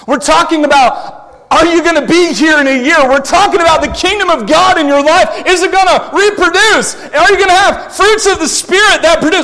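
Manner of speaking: loud, high, rising sermon shout; impassioned preaching. Acted. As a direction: preach it out full force to the church. Preaching at full force.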